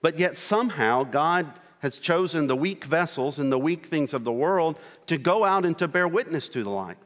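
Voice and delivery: normal talking voice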